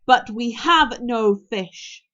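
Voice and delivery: slow, loud, deliberate firmness; firm but pleasant. A narrator's reading. Hiccup trying to dominate Toothless by personality, firm. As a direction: state it slowly, each word firmly placed. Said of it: "make his voice sound firm but pleasant".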